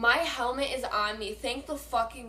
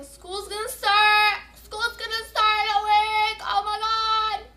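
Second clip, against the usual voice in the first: dismayed outburst; 8 semitones higher, 6 dB louder than usual. Real, not acted. Vlogger remembering school starts soon, loud wailing outburst. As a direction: cry it out in a raised singsong yell.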